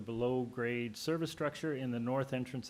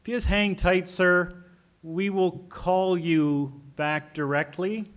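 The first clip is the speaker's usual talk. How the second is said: louder; 4 semitones higher, 11 dB louder than usual. Real, not acted. Chair calling to unheard caller.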